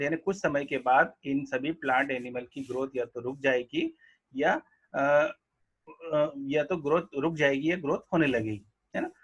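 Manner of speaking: normal talking voice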